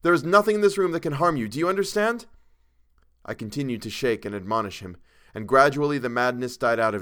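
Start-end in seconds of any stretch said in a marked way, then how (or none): 0.0-2.3 high, loud, insistent command; urgent admonishing. A narrator's reading. Narrator shaking a crazed Chalmers, admonishing him urgently. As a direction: press it on a panicked friend.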